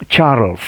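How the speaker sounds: "Charles" said the English way, one syllable, no final E. Shown as a demonstration. Teacher showing the English "Charles" to students. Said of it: "les voy a enseñar un poco de inglés".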